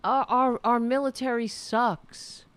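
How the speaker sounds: hammed-up indignation; mock outrage. Mocking military fans, loud sarcastic rant.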